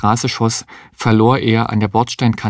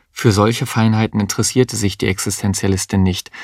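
ordinary delivery, nothing marked

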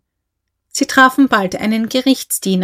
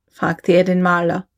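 ordinary delivery, nothing marked

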